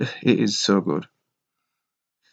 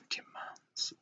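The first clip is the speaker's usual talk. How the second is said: barely audible whisper; 23 dB quieter than usual. Real, not acted. Musing on a list of demands, whispered. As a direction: echo it to yourself in a whisper. Echoing own word to herself.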